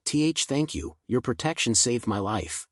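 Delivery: ordinary delivery, nothing marked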